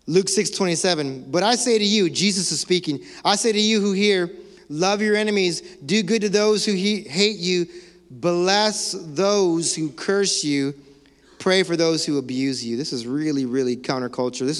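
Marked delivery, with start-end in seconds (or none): 1.3-12.8 scripture reading. Acted.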